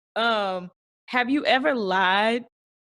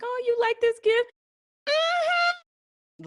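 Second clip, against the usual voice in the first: very high, sugary mock voice; mock gift-giver; much slower than usual. Friend imitating a giver fishing for praise, very high. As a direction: chirp it like an eager gift-giver. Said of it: "they'll be like".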